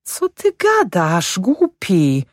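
very high, slow rebuke from a wife; acted high-pitched reproach; scolding wife. A narrator's reading. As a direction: chide your foolish husband in a high woman's voice. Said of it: "reflektowała go żona".

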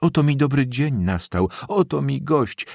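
high-pitched delighted welcome; old woman gushing over a guest; joyful old woman. A narrator's reading. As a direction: exclaim with open-hearted joy. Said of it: "zawołała staruszka z niekłamaną radością".

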